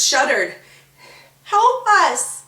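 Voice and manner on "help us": high, loud desperate cry; crying for help. Acted. Blinded Ribboners crying out, high and loud. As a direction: beg for help in panic. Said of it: "the Ribboners cried".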